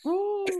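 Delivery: high, drawn-out woo; excited whoop. Real, not acted. Host cheering on his guest's story, excited whoop. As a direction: let out a high-pitched whoop.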